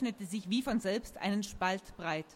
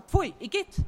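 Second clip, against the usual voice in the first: high, loud exclamation of disgust; 9 semitones higher, 6 dB louder than usual. A narrator's reading. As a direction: spit it out in revulsion. Character is disgusted by blue.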